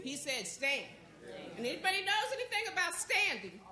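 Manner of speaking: high, forceful preacher's cadence; rally the congregation to its feet; rousing preaching. Real, not acted. Preacher telling the church to stand up, rousing preaching.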